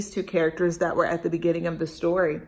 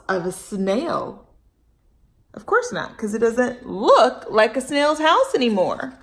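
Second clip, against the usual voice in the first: put-on expressive read-aloud for kids; storybook reading; 4 dB louder than usual. A narrator's reading. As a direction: storytime narration. Reads story to children.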